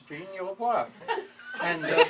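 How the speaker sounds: "Vrigne-au-Bois" as a laughing, exasperated retort. Retold or imitated. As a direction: insist "Vrigne-au-Bois" is the name, half laughing. Insisting the place name to lieutenant.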